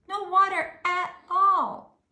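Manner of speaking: grieving for the stranded fish; dismayed. A narrator's reading. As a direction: wail it in distress.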